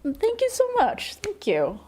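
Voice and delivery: high, moved thank you; emotional thanks. Real, not acted. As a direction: thank them, nearly tearing up. Thanking a donor, near tears.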